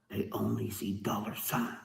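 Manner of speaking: hushed, voiceless whisper of the point; whispered aside. Shown as a demonstration. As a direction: lean in and whisper it like a secret. Repeats the line, hushed.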